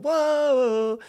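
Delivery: high, loud, long-held "whoa"; imitated answering shout. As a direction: holler back as if from across a field. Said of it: "hear back from across the field".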